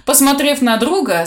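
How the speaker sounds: ordinary delivery, nothing marked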